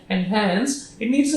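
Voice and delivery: "and hence", a pause, then a quick "it needs"; pause after hence. Shown as a demonstration. Teacher showing students the phrasing, pause after "hence". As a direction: hold a break after "hence" before "it needs". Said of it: "and hence पे pause लेना है".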